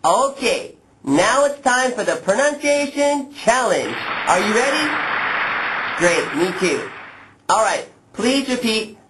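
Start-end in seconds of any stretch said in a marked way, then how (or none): none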